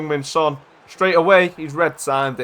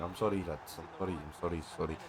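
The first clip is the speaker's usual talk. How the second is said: very soft, low mumbled apology in a fake Portuguese accent; Jota's Portuguese accent; 9 semitones lower, 17 dB quieter, faster than usual. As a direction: shrink into repeated sorries, poor Portuguese accent. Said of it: "in his little Portuguese accent".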